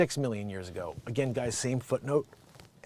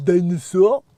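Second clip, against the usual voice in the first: put-on French accent; 7 dB louder than usual. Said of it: "Pochetto told ABC News".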